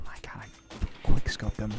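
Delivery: hushed, slow whisper. Real, not acted. Gamer whispering to viewers mid-match, slow hushed whisper. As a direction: whisper it like you don't want to jinx the shot. Whispering during a game kill.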